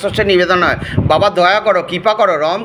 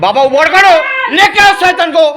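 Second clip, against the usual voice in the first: high and loud, ordering the god to act; commanding shout; 9 semitones higher, 5 dB louder than usual. Real, not acted. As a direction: bellow the command at the spirit. Exorcist commanding the devil.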